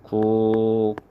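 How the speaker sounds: 국밥 said as 국빱, with a tense second syllable; tensed consonant. Shown as a demonstration. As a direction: split 국밥 in two, hard ㅃ on the second half. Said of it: "그래서 발음을 하면".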